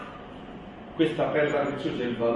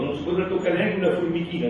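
ordinary talk, nothing marked

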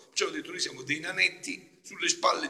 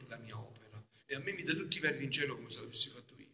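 usual speaking voice

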